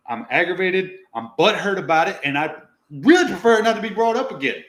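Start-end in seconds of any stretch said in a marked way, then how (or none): none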